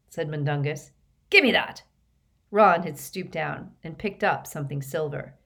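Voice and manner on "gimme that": loud, high, grabby demand; blustering rogue, pitched up; shifty petty thief. A narrator's reading. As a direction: demand it back like a flustered crook. Thief demands goods back.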